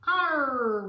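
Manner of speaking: loud pirate roar; roaring captain. Acted. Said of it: "roared the captain".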